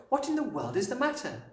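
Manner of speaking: high, surprised questioning; concerned teacher. A narrator's reading. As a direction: ask with astonished concern. Teacher greets breathless distraught child.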